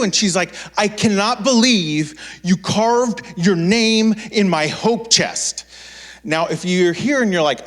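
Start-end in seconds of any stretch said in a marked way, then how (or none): 0.8-5.6 slow, higher, drawn-out accusation; hammed-up outraged mother; furious mom. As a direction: stretch out the disbelief.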